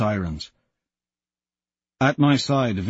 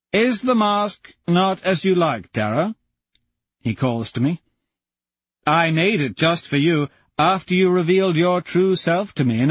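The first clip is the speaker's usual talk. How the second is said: taunting jackal; 7 semitones higher than usual. Acted. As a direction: toy with them, smug.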